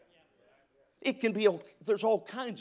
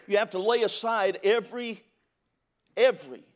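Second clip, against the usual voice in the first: every, every hammered out louder and higher; stress on every; 4 semitones higher, 5 dB louder than usual. Acted. Preacher pressing the congregation to drop every weight, stressing every, every. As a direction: lean hard on every, every. Preacher driving home the verse.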